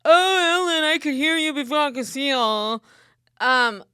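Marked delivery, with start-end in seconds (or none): 0.0-2.4 a light put-on voice teasing her loudness; mock other people. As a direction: quote people who tease her loudness. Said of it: "I get told all the time".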